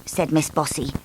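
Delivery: ordinary delivery, nothing marked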